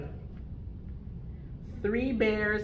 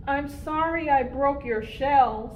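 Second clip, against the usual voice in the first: little bear cub; 7 semitones higher, 4 dB louder than usual. Acted. Bear cub Theo apologizes to Mama.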